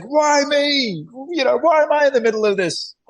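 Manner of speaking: hammed-up self-pitying squeal; pity party whine. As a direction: whine like you're backed into a corner. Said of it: "having a bit of a pity party".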